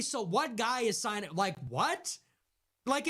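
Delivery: usual speaking voice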